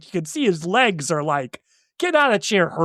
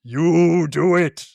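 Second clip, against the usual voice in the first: monster voice; 2 semitones lower, much slower than usual. Retold or imitated. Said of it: "the monster comes in and is like".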